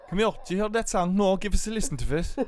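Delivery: high, loud broad Cork voice; mock Cork accent. Host hamming up a Cork lad, loud.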